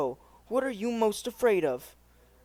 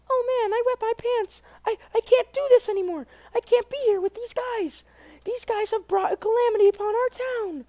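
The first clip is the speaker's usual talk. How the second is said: put-on high, fast, frightened little boy voice; a panicky kid losing his nerve, much higher; scared boy; 4 dB louder than usual.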